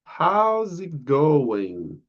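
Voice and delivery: very slow, each word laid out; slow model pronunciation. Shown as a demonstration. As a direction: model "How's it going?" slowly for learners. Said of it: "The pronunciation is like this".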